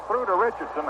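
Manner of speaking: ordinary talk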